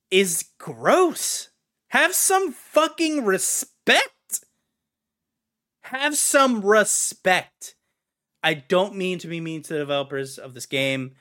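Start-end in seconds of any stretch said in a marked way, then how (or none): 1.9-4.4 high, loud, slow demand; outraged plea. Real, not acted. Fan scolding the game makers, loud drawn-out outrage. Ranting about Lord of Rings. 5.8-7.8 firm plea. Real, not acted. Fan repeating the scolding to developers, slower firmer plea.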